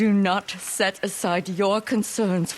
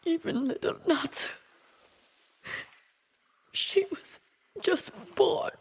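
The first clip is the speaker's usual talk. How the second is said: breaking into tears; 9 semitones higher, 5 dB quieter than usual. Acted. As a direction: voice cracking high and soft into sobs. Grandmother recalls murdered newborn.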